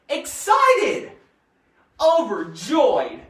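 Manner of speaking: loud, bursting with joy; big excited exclamations; acted elation. Shown as a demonstration. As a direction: punch out each word as if thrilled. Acting out synonyms for elated.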